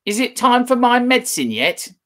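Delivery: put-on high, loud old woman calling; demanding grandma from afar; screechy grandma. Grandma calls from next room.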